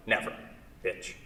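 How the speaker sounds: usual speaking voice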